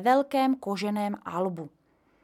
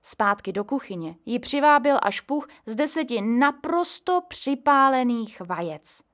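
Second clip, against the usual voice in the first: ordinary talk, nothing marked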